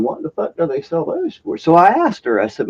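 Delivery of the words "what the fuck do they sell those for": whispered, pitched-up private wonder; inner thought. Retold or imitated. Customer staring at scouring pads, whispered bafflement. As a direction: voice the baffled thought under your breath. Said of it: "I'm thinking".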